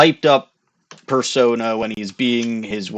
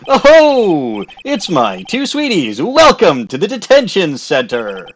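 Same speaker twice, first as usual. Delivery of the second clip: higher, louder flamboyant showman greeting; hyped-up showman; 5 semitones higher, 4 dB louder than usual. Acted. Billy Bob greeting his two sweeties, hyped-up showman. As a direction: burst in like a glitzy circus star. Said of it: "have him be that, that hyped up persona".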